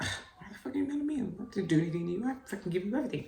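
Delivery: rapid muttered outrage under the breath; inner frustrated rant. Retold or imitated. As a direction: rattle off your silent indignation. Inner outburst at son's complaint.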